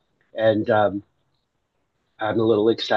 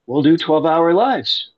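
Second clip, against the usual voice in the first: committed burst; 5 semitones higher, 5 dB louder, slower than usual. Retold or imitated. Finally accepts God's plan.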